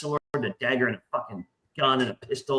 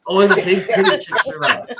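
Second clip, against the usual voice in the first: louder, laughing through the words; laughing repeat; 5 semitones higher, 8 dB louder than usual. Real, not acted. Repeating his friend's joke while laughing. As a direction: echo the joke, cracking up.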